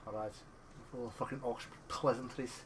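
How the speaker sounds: mock-Scottish grumble; Scottish accent. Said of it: "I don't know why I went Scottish there".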